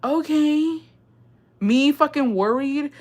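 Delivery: very high, drawn-out disbelief; high-pitched comic astonishment; incredulous relief. Retold or imitated. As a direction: squeak it slowly, stunned. Amazed relief after worrying for nothing.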